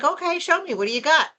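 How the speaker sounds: high, eager "show me" to the hosts; playfully egging the hosts on to reveal it; eager request. Retold or imitated. Telling the hosts to show her the gift, eagerly. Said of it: "I'm like".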